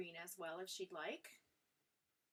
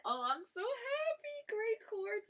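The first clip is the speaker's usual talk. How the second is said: high, slow, gushing delight; overjoyed; 14 semitones higher, 9 dB louder, slower than usual. Real, not acted. Thanking a viewer for her kind words, overjoyed and much higher. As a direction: near tears with happiness. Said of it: "You're gonna make me cry".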